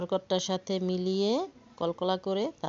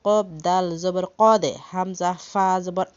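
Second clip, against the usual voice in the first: loud, spaced letter-by-letter chanting; spelling drill; 7 dB louder than usual. Shown as a demonstration. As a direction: call out each letter for the class to follow.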